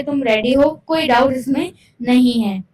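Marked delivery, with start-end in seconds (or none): none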